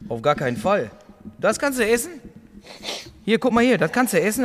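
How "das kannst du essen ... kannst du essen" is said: incredulous. Real, not acted. Disbelief at eating the fish.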